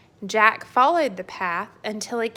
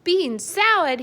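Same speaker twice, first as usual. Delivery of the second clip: high, loud disbelieving cry; outraged cry; 9 semitones higher, 5 dB louder than usual. Acted. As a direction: shoot it up in disgust. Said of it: "he cried".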